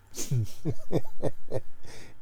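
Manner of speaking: real burst of laughter. Real, not acted. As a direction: break into a long, voiceless laugh. Host laughs between repeated words.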